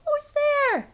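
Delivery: high, startled squeaky cry; a tiny mouse calling into the dark; mouse cries out. Acted. Mouse hears someone at the cave, high frightened squeak. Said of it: "Mouse cries".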